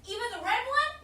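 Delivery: eager question. Acted. Tempted to push the button.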